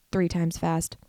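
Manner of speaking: ordinary delivery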